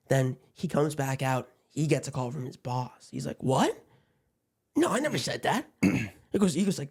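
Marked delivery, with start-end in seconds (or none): 3.4-5.7 higher-pitched imitation of a surprised denial; mock indignant denial. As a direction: act out an innocent protest. Driver denies it to his boss.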